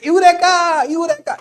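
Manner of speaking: high, loud excited shout; mock triumphant shout; shouting Eureka. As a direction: yell it out in triumph. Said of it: "चिल्लाते हुए जाते".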